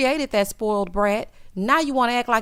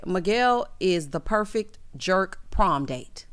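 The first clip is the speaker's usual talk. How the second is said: ordinary talk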